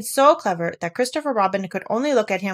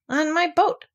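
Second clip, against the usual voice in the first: proud; 10 semitones higher, slower than usual. A narrator's reading. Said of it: "said Pooh proudly".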